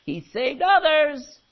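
loud, high, drawn-out jeer; mocking. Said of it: "They mocked him".

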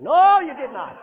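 loud denial. Real, not acted. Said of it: "repeated denial".

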